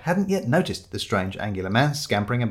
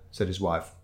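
plain reading voice

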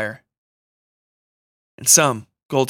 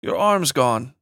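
put-on a touch of Mexican accent; light Mexican accent; slower than usual. A narrator's reading. Woman tells injured man about his arm, light Mexican accent. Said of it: "in her light Mexican accent".

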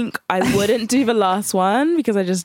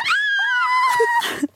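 imitated crowd scream.

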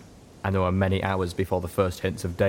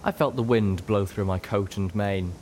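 usual speaking voice

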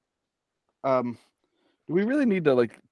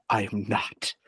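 whispering; 3 semitones lower, 4 dB quieter than usual. Pretend. Denying he is phallic, hushed.

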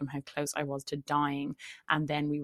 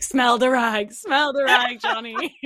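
loud, high taunting voice; loud mock taunt; 7 semitones higher, 10 dB louder, slower than usual. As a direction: shout it teasingly, pitched up. Voicing Amber Heard mockingly.

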